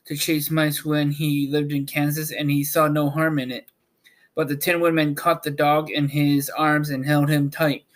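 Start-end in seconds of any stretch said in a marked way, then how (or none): none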